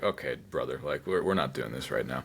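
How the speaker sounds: muttered brush-off. Retold or imitated. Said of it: "I was like".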